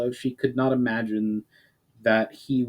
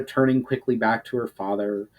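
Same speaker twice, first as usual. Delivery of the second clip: usual speaking voice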